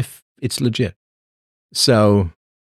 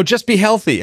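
much higher, sing-song mock advice; mocking quote; 12 semitones higher than usual. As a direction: parrot the advice in a silly high voice.

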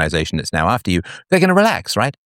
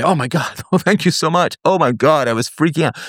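higher, laughing, imitated gush of relief; mock huge relief; 9 semitones higher than usual. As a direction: act out the friend's laughing relief, pitched up. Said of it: "Huge relief".

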